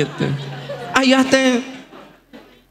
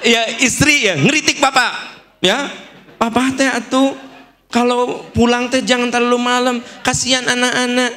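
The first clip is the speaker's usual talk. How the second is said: higher-pitched wife's complaint; nagging wife; 5 semitones higher than usual. Acted. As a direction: plead like a wife criticizing her husband. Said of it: "Itu misalnya saya istri Anda".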